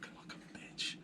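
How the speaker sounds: whispering. Acted. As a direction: whisper the echo back. Echoed in a whisper.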